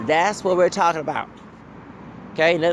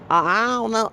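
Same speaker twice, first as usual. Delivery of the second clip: usual speaking voice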